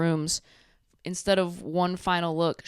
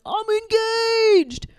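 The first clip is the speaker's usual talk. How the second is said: very high, loud, stretched-out shriek of joy; mock excited squeal; 8 dB louder, much slower than usual. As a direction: squeal it like giddy news, dragged out slowly. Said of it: "is like".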